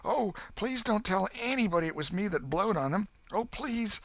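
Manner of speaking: put-on high, begging boy's plea; pleading exclaim. A narrator's reading. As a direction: beg him not to tell. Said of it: "Huck sprang up and exclaimed".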